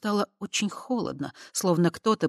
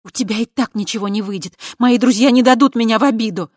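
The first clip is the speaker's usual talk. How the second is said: loud, high, angry retort; 7 semitones higher, 10 dB louder than usual. Acted. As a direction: fire it back angrily. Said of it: "рассердил девушку".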